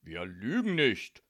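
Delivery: mildly outraged, each word pressed; indignant. A narrator's reading. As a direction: deny it with offence. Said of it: "schrie Jannik empört".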